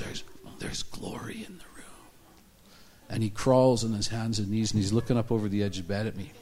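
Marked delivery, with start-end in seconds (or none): 0.0-1.8 hushed, breathy whisper; imitating someone whispering in wonder; awed whisper. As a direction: whisper it as if awestruck. Retells an awed whispered remark.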